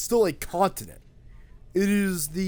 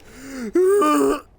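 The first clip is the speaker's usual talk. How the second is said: loud gasp; 8 semitones higher, 8 dB louder than usual. Real, not acted. Sudden loud reaction.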